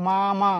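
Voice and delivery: each "মা" its own long syllable; split syllables. Shown as a demonstration. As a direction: say "মা মা" as two separate, even beats. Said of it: "থামা লাগছে না".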